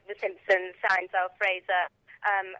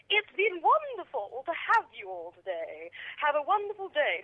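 imitating a posh air hostess; 6 semitones higher than usual. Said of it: "She put this really posh voice on".

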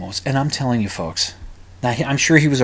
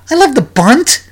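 incredulous; 12 semitones higher, 6 dB louder than usual. Real, not acted. Incredulous repeat of the quote.

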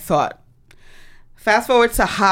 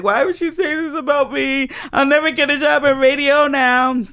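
high, whiny wail imitating her past self; mock crying; 6 semitones higher than usual. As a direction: re-enact a tearful whine, pitched up. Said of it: "I was crying. I'm like".

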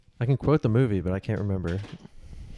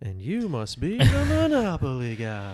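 higher, drawn-out comic character voice; hammed-up movie quote; 5 semitones higher, slower than usual. Quoting a comedy film to podcast friends, hammed-up and drawn out. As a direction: stretch the key word out high and theatrical.

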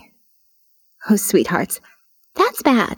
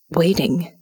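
ordinary delivery, nothing marked

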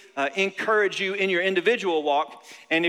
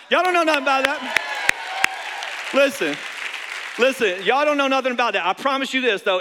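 swaggering boast; 5 semitones higher than usual. Real, not acted. Boasting after rap.

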